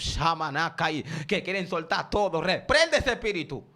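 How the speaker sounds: loud, high, impassioned preacher's cry; fiery Pentecostal sermon delivery; full-force preaching. Acted. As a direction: preach it hard to the congregation.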